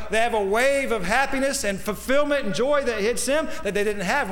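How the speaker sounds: high-pitched, surging sermon delivery; impassioned preaching. Real, not acted. Preacher telling of the joy found in serving, impassioned. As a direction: carry them upward on a swell of fervor.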